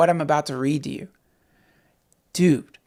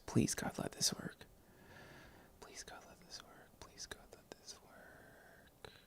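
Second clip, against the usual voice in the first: hushed plea to God, dying away; whispered prayer; 25 dB quieter than usual. Real, not acted. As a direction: beg under your breath that it works. Praying the page will load.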